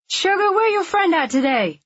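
sassy, high-pitched busybody; nosy woman in line. A narrator's reading. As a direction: pipe up high and pry at a stranger. Nosy stranger questioning a girl.

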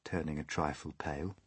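plain reading voice

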